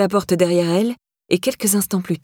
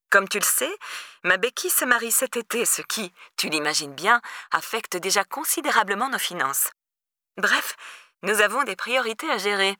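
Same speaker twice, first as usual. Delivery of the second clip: singsong stepmother; 2 semitones higher, 6 dB quieter than usual. A narrator's reading. Stepmother excusing herself over family finances, singsong and softened. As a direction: explain it softly, with a searching lilt. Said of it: "comme si elle s'apprêtait à chanter".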